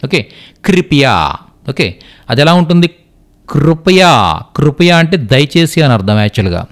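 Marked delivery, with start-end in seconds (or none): 0.6-1.3 కృపయా said "kripiya", drawn out; Hindi kri reading. Shown as a demonstration. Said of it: "కృపయా అంటాం". 3.5-4.4 కృపయా said "krupaya"; Telugu kru reading. Shown as a demonstration. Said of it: "కృపయా అని పలకకుండా".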